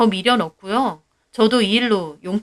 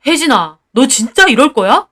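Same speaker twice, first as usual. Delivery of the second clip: angry husband; 3 semitones higher, 7 dB louder than usual. Acted. Husband arguing with wife.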